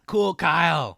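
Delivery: big, playful announcer shout; hammed-up self-introduction. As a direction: belt out your nickname like a hype man. Co-host announces own name, hammed.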